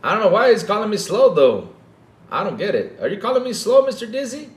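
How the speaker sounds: high, hammed-up worried voice; mock-scared Dreamcast guy. Imitating the Dreamcast guy confronting Mister Dizzy, mock scared. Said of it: "you need to seem scared".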